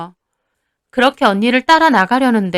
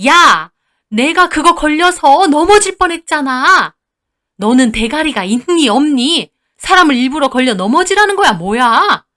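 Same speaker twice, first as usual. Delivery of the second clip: raised-voice scolding, pitched up; angry yelling; 5 semitones higher, 5 dB louder than usual. Acted. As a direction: tear into the daughter-in-law. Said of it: "저한테 마구잡이로 화내고 소리 지른 적이". (버럭 소리치며 혼내는 시어머니)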